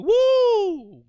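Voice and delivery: high loud preacher's whoop; ecstatic woo. Real, not acted. Preacher exulting over Esau, high loud whoop. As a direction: let out a joyful cry.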